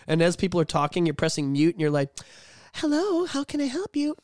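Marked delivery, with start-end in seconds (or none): 2.7-4.1 high, sing-song customer-service hello; polite phone agent. Acted. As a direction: put on a sweet helpline voice. Acting cheery agent for callers.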